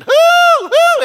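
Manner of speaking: very high, loud hooting cries; hooting yell. Retold or imitated. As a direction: hoot loudly to scare off the burglar. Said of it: "She went".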